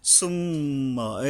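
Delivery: "সুম্মা" with the doubled m hummed long; nasal hold. Shown as a demonstration. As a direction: linger through the nose on the mm of "সুম্মা". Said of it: "মিমের উপরে আবার গুণনা করতে হবে".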